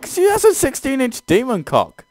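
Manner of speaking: high, loud, incredulous; exasperated outburst. Real, not acted. Player explaining why he quit, in a high loud exasperated cry. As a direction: spit it out in disbelief.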